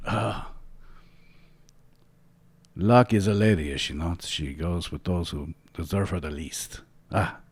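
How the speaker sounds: Salvador. Acted. Waking captain laments his lost ship, lightly voiced character. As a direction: start emphatic, then settle into a wistful farewell.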